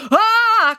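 loud, high scream; sudden high yell of alarm; falling cry. A narrator's reading. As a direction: cry out as you fall. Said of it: "cried Friday as she fell".

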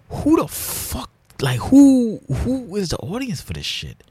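high-pitched, incredulous outburst; exasperated disbelief. Real, not acted. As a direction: squeak up in disbelief at who watches this. Ranting about bad-faith influencers.

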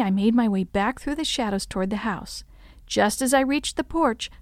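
usual speaking voice

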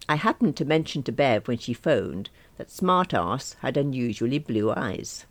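ordinary delivery

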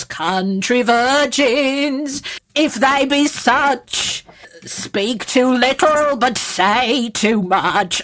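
put-on slow, grand posh English accent; posh narrator accent. Friend recites her line slowly in posh English accent. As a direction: draw each word out in a grand posh accent. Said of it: "to do it in one of those sort of accents".

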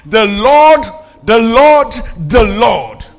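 THE LORD punched loud each time, with pauses between; "Lord" stretched and hit hard; emphatic repetition. Shown as a demonstration. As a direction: hammer out "The Lord" as separate, loud declarations. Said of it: "my emphasis".

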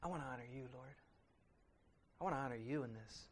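quiet, earnest vow to God; humble prayer. Retold or imitated. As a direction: pray it gently and humbly. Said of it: "we have to say".

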